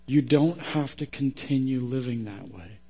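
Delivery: low, hushed sermon close; prayerful preaching. Real, not acted. Preacher praying for struggling listeners, hushed and earnest.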